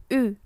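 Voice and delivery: "U" said as a rounded "ü"; French pursed U. Shown as a demonstration. Teacher demonstrating the technique, pursed French vowel. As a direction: shape lips for "u", say "i": "U". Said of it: "akan keluar bunyi seperti itu".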